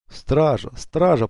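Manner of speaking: choked gurgling call for guards; gurgling cry. A narrator's reading. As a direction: gurgle it out while being strangled. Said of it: "пробулькал Эмилио".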